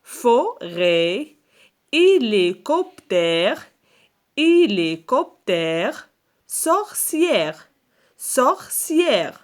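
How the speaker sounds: slow word-list dictation with separated syllables. Shown as a demonstration. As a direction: sound out each word for careful listening. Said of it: "repère le son qui se répète".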